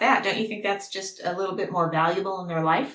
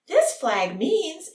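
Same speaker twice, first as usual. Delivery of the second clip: higher, drawn-out mock excitement; excited presenter; 7 semitones higher, much slower than usual. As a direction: show it off slowly to preschoolers, excited and happy. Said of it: "you're all excited and happy".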